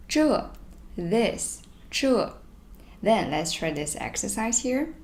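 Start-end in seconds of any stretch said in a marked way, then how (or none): 0.1-0.5 这 said high and crisp as zhè, falling tone; pronouncing 这. Shown as a demonstration. Teacher models 这 for her class, high and clear. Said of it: "it is pronounced as". 1.9-2.3 这 said high and crisp as zhè, falling tone; pronouncing 这. Shown as a demonstration. Teacher models 这 for her class, high and clear. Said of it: "it is pronounced as".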